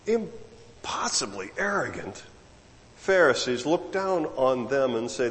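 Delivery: normal talking voice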